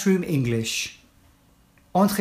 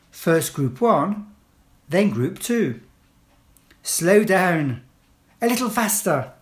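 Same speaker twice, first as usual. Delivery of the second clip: firm, clear classroom instructions; teacher giving commands; 3 semitones higher than usual. Shown as a demonstration. Teacher to pupils entering class, firm clear commands.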